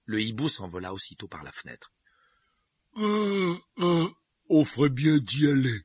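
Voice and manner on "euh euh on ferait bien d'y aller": loud yawn through the words. A narrator's reading. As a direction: stretch and yawn while speaking. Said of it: "avec un bâillement sonore".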